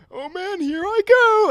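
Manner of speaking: high, loud, drawn-out wail imitating a groom losing it; mock breakdown. Best man retells the groom seeing his bride, mock wailing. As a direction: wail it slowly, hammed-up, as if bursting into tears. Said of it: "he, like, just lost it".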